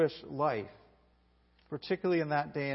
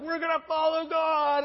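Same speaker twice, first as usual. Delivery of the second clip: much higher, loud shouted vow; crowd shout; 10 semitones higher, 5 dB louder than usual. Retold or imitated. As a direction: roar it back as the rallied crowd. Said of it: "and they're like".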